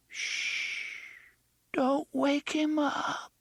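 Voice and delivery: put-on high, slow, hushed little child; frightened child voice. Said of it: "the child's voice mourned".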